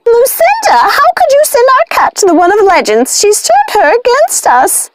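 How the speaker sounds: high, fretful witch voice; worried witch. A narrator's reading. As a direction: fret and blame Lucinda. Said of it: "pacing with worry".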